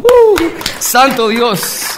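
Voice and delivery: high loud joyful whoop; excited whoop. Real, not acted. Preacher whooping to the congregation, joyful whoop. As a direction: let out a church-style cry of joy. Praise shout during testimony.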